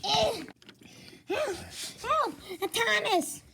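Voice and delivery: high panicked calls; cry for help. Acted. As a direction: call out in a panic. Engine crying for help.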